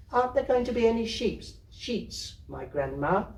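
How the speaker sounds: put-on high, quick little girl; child in bed. Kezia asking her grandmother about sheets, high and quick. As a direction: ask like a sleepy small child. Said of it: "Kezia in bed".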